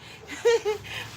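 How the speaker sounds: high squeaky laugh; nervous laughter while stressed out. Real, not acted. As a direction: giggle through the nerves. Laughing at her fright.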